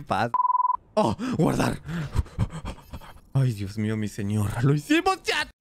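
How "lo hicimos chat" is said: high, bright victory shout; triumphant cheer. Real, not acted. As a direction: cheer the chat on a win. Triumph after escaping.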